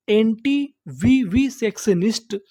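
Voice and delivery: "anti-vivisectionist" very slowly, piece by piece, pitch rising at the end; broken into syllables. Shown as a demonstration. Teacher breaking a long word into pieces for learners, syllable by syllable. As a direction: sound out "anti-vivisectionist" in separate chunks. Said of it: "टुकड़ों में तोड़-तोड़ के पढ़ना है".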